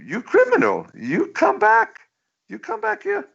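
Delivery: high, strained mock dictator yelling after him; cartoon villain voice pushed way up high; cartoon Pol Pot voice. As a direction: squeal it like a cartoon tyrant. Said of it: "Pol Pot is saying".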